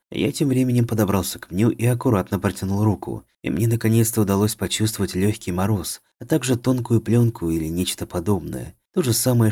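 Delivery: usual speaking voice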